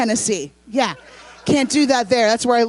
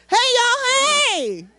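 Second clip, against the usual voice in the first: high, loud Southern holler; quaint Southern greeting; 12 semitones higher, 3 dB louder, much slower than usual. Acted. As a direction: sing out a Southern hello. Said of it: "being quaint as fuck, like I'm from the South".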